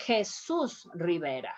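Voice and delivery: "Jesus" said heh-SOOS, J as H; Spanish pronunciation. Shown as a demonstration. Teacher models the patient's name, Spanish pronunciation. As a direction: open "Jesus" with a Spanish H sound, stress the second syllable. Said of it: "You have to pronounce".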